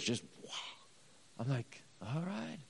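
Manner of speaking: soft, rising, taken aback; a hushed, amazed all right; quiet surprise. Retold or imitated. Pastor startled as a stranger bursts into tears, softly surprised. Reacting as the woman cries.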